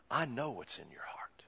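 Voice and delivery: hushed, breathy whisper. Acted. Jesus to the scribes, whispered knowing.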